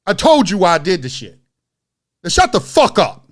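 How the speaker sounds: hard, emphatic warning in retelling; retold street-tough telling-off. Creator showing how he lets her know he's from the street, tough telling-off. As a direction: act out putting someone in their place. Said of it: "let her know I'm from the street".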